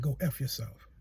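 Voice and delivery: low, soft, solemn voice of God; God's voice. Retold or imitated. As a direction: speak it down low as God would. God speaks in the retold vision.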